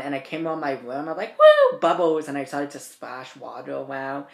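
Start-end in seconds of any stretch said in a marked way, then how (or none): 1.4-2.3 high, very loud delighted cheer; a teen taunting staff by cheering at the flood; gleeful whoop. Retold or imitated. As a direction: whoop like a kid in a bubble bath. Splashing water gleefully.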